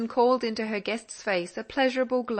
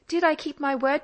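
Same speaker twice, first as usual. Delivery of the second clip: put-on eager young girl; 6 semitones higher, 5 dB louder than usual. A narrator's reading. Polly asking her father.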